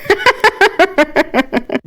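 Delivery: high, rippling giggle; acted girlish peal of laughter; hearty laugh. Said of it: "ضحكت غادة من قلبها".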